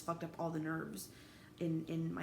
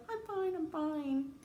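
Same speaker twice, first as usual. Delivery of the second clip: forced "I'm fine"; 9 semitones higher, 3 dB louder, slower than usual. Pretend. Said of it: "It's been really hard to be like".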